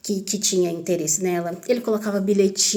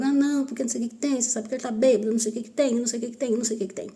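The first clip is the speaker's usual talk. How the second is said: higher, rattled-off imitation of a talking-to; brother imitated; 4 semitones higher, much faster than usual. Brother talking her down in the car, higher imitated patter. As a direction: skim through his excuses in a higher voice. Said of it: "conversou comigo".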